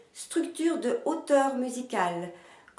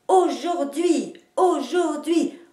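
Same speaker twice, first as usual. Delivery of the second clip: slow aujourd'hui, stressed on both first and last syllables; aujourd'hui high and slow with two strong beats; double accent; 5 semitones higher, 4 dB louder, slower than usual. Shown as a demonstration. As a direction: hit "au" and "hui" in aujourd'hui, taking it slowly. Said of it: "en marquant les deux places de l'accentuation".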